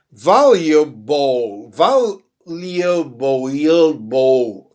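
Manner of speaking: valuable broken slowly into syllables, Y glide before the end; audible Y sound. Shown as a demonstration. Teacher showing Brazilian learners the hidden sound, slow valuable with a Y. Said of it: "Tem um som de Y aí".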